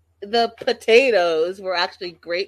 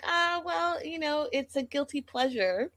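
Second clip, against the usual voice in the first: lightly voiced mom, higher and airy; a hint of a sheepish mother admitting it; light mom impression; 9 semitones higher, 5 dB quieter than usual. To viewers, quoting the mom's sheepish excuse, lightly higher mock voice. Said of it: "And the mom's like".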